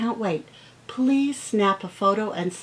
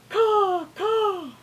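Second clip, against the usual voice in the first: high, drawn-out caws; crow call; 13 semitones higher, 3 dB louder than usual. Acted. Teacher asks which bird this is, high crow caws. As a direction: caw like a crow or seagull. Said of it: "Does that sound like a crow".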